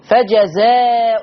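slow فجزاء ending in -un; long aa, then nasal -un on فجزاء; tanween on hamza. Shown as a demonstration. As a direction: say فجزاء as "fajazaaa'un". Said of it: "قرأ بتنوين الهمزة".

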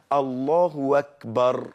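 الله أكبر slow, run together as "Allahu-wakbar"; hamza becomes waw. Shown as a demonstration. Teacher quoting the form jurists accepted, waw for hamza. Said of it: "بالواو".

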